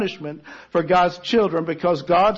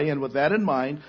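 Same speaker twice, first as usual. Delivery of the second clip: usual speaking voice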